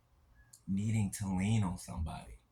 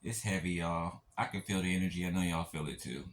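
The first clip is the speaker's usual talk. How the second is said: ordinary talk, nothing marked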